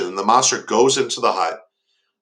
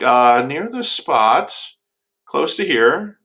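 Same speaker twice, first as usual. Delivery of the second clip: slow, stalling answer that climbs high; stall, then offer a vague, higher-pitched answer; evasive retelling; 5 semitones higher, slower than usual. Retold or imitated. Monster answers the suspicious old man, hedging evasively.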